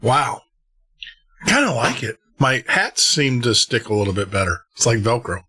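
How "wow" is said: ordinary talk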